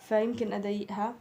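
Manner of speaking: normal talking voice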